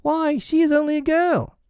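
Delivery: put-on very high, scandalized boy; aghast. A narrator's reading. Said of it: "John said aghast".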